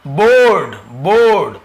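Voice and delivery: बोर्ड loud, with a long ओ; drawn BO in board. Shown as a demonstration. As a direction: punch and stretch the vowel of बोर्ड. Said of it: "यहाँ तो बो का ध्वनि". (बोर्ड, लंबे ओ से)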